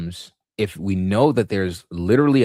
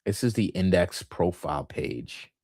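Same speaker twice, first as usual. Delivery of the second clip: usual speaking voice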